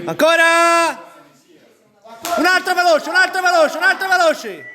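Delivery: high, loud repeated push; shouting encouragement. Real, not acted. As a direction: bark the reps out. Final reps, coach yelling.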